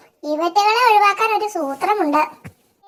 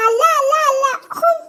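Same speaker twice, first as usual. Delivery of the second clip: high, tearful repeated protest; scared crying no; 6 semitones higher, faster than usual. Acted. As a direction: refuse in sheer panic. (പേടിച്ചു കരഞ്ഞ് നിഷേധിക്കുന്നു)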